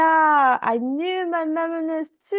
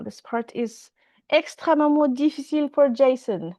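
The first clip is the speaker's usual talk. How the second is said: ordinary talk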